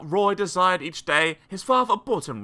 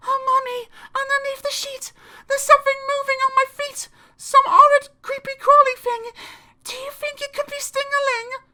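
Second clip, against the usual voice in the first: put-on very high, breathless child's voice, fast and loud; a scared kid squealing to mum; terrified little child; 5 dB louder than usual. Frightened child calls to mother.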